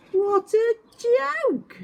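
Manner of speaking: very high, slow jeering voice; a stoat laughing at the brothers; mocking stoat. Stoat mocks the brothers, high and slow. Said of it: "said a stoat".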